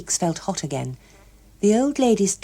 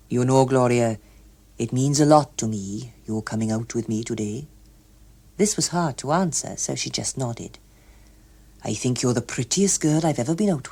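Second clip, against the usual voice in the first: earnest suitor, lower voice; 5 semitones lower than usual. A narrator's reading. Hughes Evans courting Gloria.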